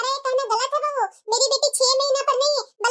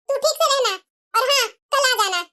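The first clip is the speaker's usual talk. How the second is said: ordinary talk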